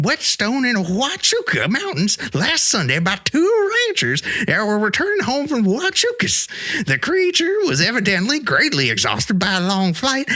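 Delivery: put-on high old-timer newsreader voice, way up; old prospector. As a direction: read it like a crusty old prospector. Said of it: "Read it like an old prospector".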